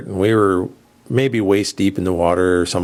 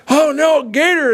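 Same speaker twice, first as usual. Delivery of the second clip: panicked cry; 4 dB louder, slower than usual. Retold or imitated. Retelling friend fleeing from gator.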